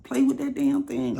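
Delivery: very high shocked put-on voice; mock shocked woman. Woman repeating his suggestion, shocked high voice. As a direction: shriek it back in disbelief.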